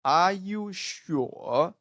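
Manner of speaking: high slow "Are you", then long dipping "sure?"; exaggerated doubtful "Are you sure?", lifting at the end; third-tone tune. Shown as a demonstration. Teacher reminds students of third tone, exaggerated question. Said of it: "The third tone".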